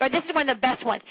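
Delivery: usual speaking voice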